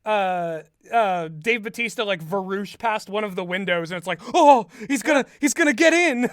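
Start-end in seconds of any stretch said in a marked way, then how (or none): 4.3-6.3 higher, louder mock-alarmed cry; hammed-up fright at the window; mock panic. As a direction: yelp it like a spooked horror victim. Family sees attacker at window.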